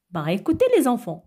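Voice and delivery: ordinary talk, nothing marked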